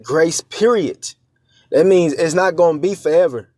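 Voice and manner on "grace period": Grace period drawn out slowly, each word weighted; slow, deliberate stress on Grace period; emphasis on grace period. Shown as a demonstration. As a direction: lean hard on Grace period to drive it home. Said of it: "I'm putting emphasis on that".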